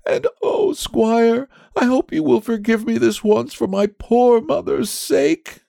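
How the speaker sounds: put-on very high, pleading child voice; tearful boy. A narrator's reading. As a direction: beg forgiveness as a sobbing little boy. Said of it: "answered the boy between his sobs".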